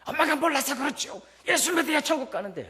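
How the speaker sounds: high, loud mocking voice of a smug child; mock a know-it-all child looking down on mom; condescending child. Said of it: "이러면 안 된다는 거예요".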